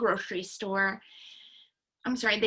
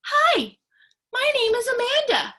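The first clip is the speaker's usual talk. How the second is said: much higher, louder bright greeting; cheerful; 13 semitones higher, 8 dB louder than usual. Acted. Girl trying a new name at the mirror, cheerful and high. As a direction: chirp it like a brand new you. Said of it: "she said cheerfully".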